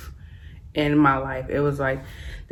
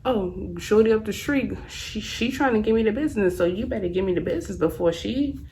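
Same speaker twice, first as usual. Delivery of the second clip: mock pushy boyfriend; 6 semitones higher than usual. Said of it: "he was like".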